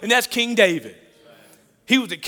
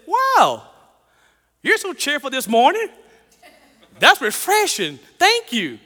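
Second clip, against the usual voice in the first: surprised lady; 6 semitones higher, 4 dB louder than usual. Retold or imitated. Rude lady surprised by cheer.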